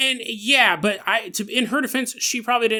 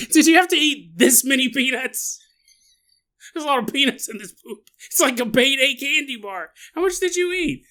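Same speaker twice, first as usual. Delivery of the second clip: higher, louder whiny complaint; mock disgusted complaint; 7 semitones higher, 3 dB louder than usual. Client complaining about the peanuts: high mock whine. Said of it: "He's like".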